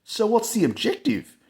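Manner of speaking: high mock Aussie voice; Aussie mate asking. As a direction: pipe up curious in a high put-on voice. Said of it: "And he goes".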